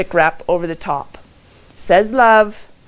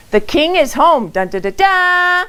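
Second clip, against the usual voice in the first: high mock-royal announcement with cheer; playful fanfare; 11 semitones higher, 4 dB louder than usual. Speaker greets the king coming home, high playful fanfare. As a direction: sing out a jubilant pretend fanfare.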